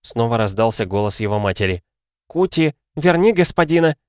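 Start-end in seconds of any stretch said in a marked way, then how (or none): 2.3-4.0 high, loud mother; demanding cry. A narrator's reading. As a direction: order the boy in a raised voice. Mother demands over the phone. (громкое требование матери)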